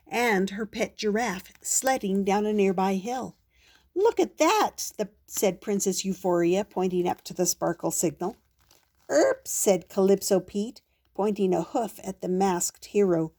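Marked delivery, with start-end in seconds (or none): 3.9-4.9 much higher, amazed exclaim; surprised. Acted. Princess spots the signal. 9.1-9.5 high squeaky animal erp; giraffe noise. Acted.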